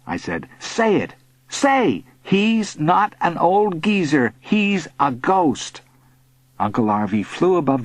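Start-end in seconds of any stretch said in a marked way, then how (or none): 0.6-5.8 higher, slow, emphatic dictation; firm demand. A narrator's reading. Boy forcing bully to repeat, insistent. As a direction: make them say it word for word.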